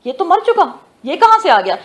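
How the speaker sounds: as if seeing a dead brother alive; shocked disbelief. Acted. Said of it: "وہ تو شوق ہو گئے".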